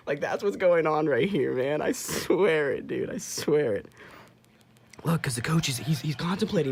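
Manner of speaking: convince the viewers he's right; excited insisting. Real, not acted. Fan insisting to viewers Nick called it, loud and excited.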